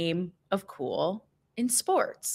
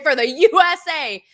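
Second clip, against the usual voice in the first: higher, louder patriotic shout-out; fired-up rally cry; 7 semitones higher, 8 dB louder than usual. Retold or imitated. Player rallying his teammates mid-game, excited shout. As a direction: yell it for the team with a bloody grin. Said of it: "and he's like".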